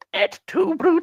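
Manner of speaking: high, squeaky mock-tragic goblin voice; a dying goblin hams up its last words. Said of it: "looks up at you and goes".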